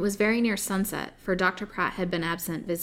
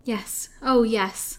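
slow, higher, timid plea; faltering; 4 semitones higher, 5 dB louder, much slower than usual. Acted. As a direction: falter like a desperate captive. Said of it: "faltered the poor creature".

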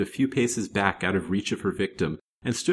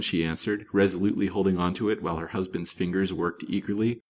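ordinary delivery, nothing marked

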